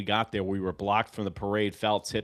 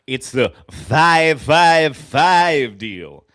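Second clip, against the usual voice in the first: slow, much louder, stretched-out chant; drawn-out proclamation; 4 semitones higher, 12 dB louder, much slower than usual. Real, not acted. Fan announcing his five-five-five ritual, slow loud chant. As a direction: belt it out slowly like a rallying cry.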